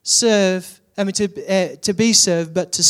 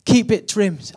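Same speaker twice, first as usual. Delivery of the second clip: usual speaking voice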